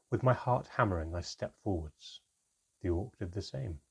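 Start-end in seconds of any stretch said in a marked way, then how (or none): none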